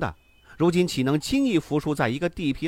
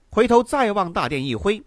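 plain reading voice

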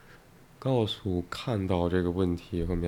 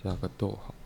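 dictating punctuation; 6 dB quieter than usual. Shown as a demonstration.

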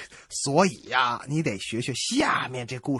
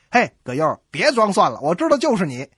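loud teasing call; 6 semitones higher, 7 dB louder than usual. Acted. Calling out, teasing the stranger.

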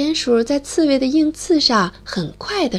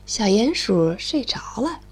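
ordinary delivery

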